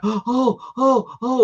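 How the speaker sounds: high, rising "oh, oh, oh" in mimicry; imitated moaning. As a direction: mimic the neighbour's moans overheard from the garden. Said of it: "like this".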